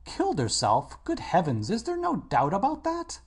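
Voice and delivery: shocked, the last question rising high; horrified cry. Acted. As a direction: gasp it out in horror. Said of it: "cried Hallward ... expression of horror".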